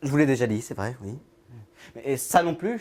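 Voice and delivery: normal talking voice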